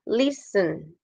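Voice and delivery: listen starting on a firm LIS, sound dropping on sen; LISten with a sinking second syllable; falling after first syllable. Shown as a demonstration. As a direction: let listen fall away after LIS. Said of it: "como que cae el sonido".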